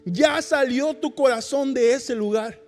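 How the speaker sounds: ordinary talk, nothing marked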